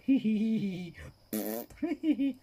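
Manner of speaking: squeaky falsetto hee-hee; high mock giggle. Imitating the ghost's giggle in a high squeak. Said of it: "It's like".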